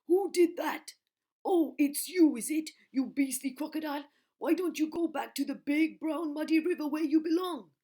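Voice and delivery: very high, loud elephant voice; Trunky elephant. Acted. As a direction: tell off the croc. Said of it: "in his big, deep voice".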